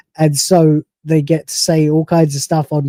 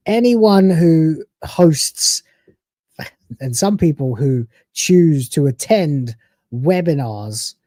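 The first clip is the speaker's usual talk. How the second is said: slow emphatic; slower than usual. Real, not acted.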